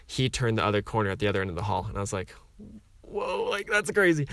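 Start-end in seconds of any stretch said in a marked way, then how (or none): 3.0-4.3 high, startled whoa at a coincidence; surprised whoa. Retold or imitated. Quoting his own amazed reaction on seeing Brother Bartholomew. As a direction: let it jump up in mock amazement. Sees him turning the corner.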